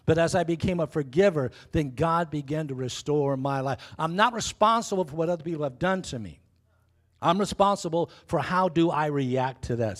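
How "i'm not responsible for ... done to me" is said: ordinary delivery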